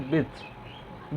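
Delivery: softly spoken विड्थ, D rushed into TH; quick, swallowed D. Shown as a demonstration. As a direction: run the D of विड्थ straight into a breath of TH. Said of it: "द थोड़ा जल्दी बोलना होता है". (धीमे से विड्थ, द जल्दी में)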